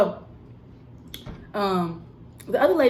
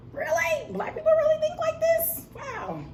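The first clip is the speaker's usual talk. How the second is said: put-on much higher, breathy-free disbelieving girl voice, pitched way up; 4 dB quieter than usual. Coworker's stunned disbelief at the narrator, mock high girl voice. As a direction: play her gasping in disbelief at you. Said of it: "she looked at me sometimes like".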